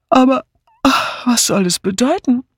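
high voice through a yawn. A narrator's reading. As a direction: ask it while yawning. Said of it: "fragte Julia gähnend". (gähnend gefragt)

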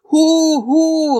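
high, mocking "Hu, hu"; high jeering hoots. A narrator's reading. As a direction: hoot at him from the crowd. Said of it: "riefen die Vaterlandsverräter". (spöttisches Johlen)

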